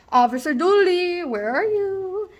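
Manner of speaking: high, drawn-out mocking call; singsong calling out. Student to absent probation officer, calling out in singsong. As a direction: call playfully for someone who isn't there.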